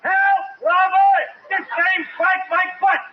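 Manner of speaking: put-on boy shouting for his friend's help; yelling for help. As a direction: yell for Robert across the street. Kid yells for help.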